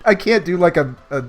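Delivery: normal talking voice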